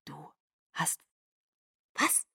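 leise, stockend geflüstert; flüstere es ungläubig; fassungsloses Flüstern. Acted. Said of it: "flüsterte ich". (Bella kann es nicht fassen, leise geflüstert)

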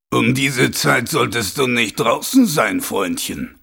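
slow, loud, threatening stranger's voice; menacing challenger. A narrator's reading. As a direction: warn him off slowly, with menace. Stranger challenges Mumm at night.

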